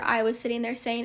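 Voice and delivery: normal talking voice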